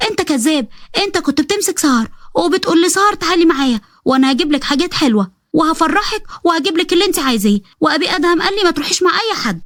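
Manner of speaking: put-on high childish voice calling him a liar; little girl accusing. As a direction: tattle like a wronged little girl.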